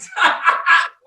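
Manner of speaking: a loud, sudden burst of real laughter; big laugh. Real, not acted. Laughing loudly at her own morning devotion story.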